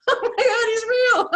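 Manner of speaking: high, loud laughing cry of amazement; laughing disbelief. Real, not acted. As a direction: laugh it out, stunned.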